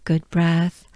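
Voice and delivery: ordinary talk, nothing marked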